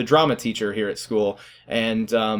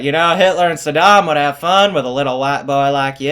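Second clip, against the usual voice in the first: louder old-teacher twang, imitated; twangy teacher impression; 2 semitones higher, 6 dB louder than usual. Teacher warning a student about cheaters, loud twangy impression. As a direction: lay the twang on thick and loud. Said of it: "I do a spot-on impression of him".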